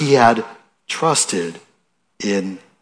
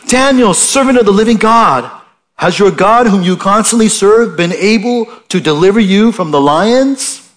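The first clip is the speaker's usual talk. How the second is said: raised, anxious call to Daniel; troubled cry; 7 semitones higher, 4 dB louder than usual. A narrator's reading. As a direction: call out worried and loud. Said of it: "he cried out with a troubled voice".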